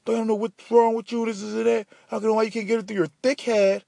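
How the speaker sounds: high, exasperated mock scold; hammed-up furious teacher; teacher freaking out. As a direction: imitate the teacher's angry outburst. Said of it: "she freaked out".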